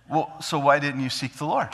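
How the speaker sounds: normal talking voice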